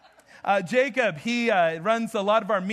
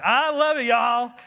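louder, hammed-up Southern drawl; playful Southern; 5 dB louder than usual. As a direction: ham it up like a Southerner. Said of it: "that was so Southern".

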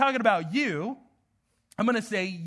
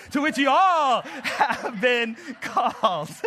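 slow Southern drawl, laughing through it; attempted Southern; 3 semitones higher, slower than usual. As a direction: stretch it out in mock Southern, cracking up. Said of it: "Maybe I'll become Southern one day".

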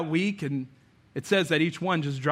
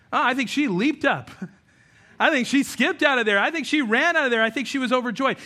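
excited; 6 semitones higher, 4 dB louder than usual. Real, not acted. Joy after forgiveness, rising delivery.

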